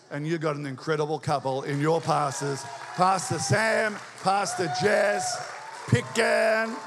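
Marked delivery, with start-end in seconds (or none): 3.0-6.7 loud, drawn-out names like an announcer; rousing shout-out. Real, not acted. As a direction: call out the names to rouse applause.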